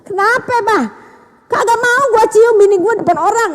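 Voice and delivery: loud, high-pitched comic quarrel; indignant refusal. Acted. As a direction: act out a husband loudly refusing affection in public. Acted couple's quarrel over public kiss.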